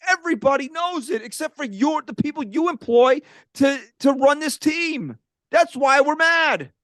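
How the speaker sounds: rising, slowing angry rant. Real, not acted. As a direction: build the fury to the end. Fan rant building to anger.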